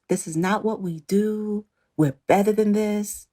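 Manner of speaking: slow, mock-righteous imitation of castmates; mock preachy quote. Mocking castmates' earlier stance.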